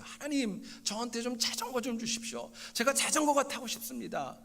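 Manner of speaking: high-pitched imitation of a child praying aloud; anak berdoa lantang. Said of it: "목소리가 굉장히 크게 기도를 했어요".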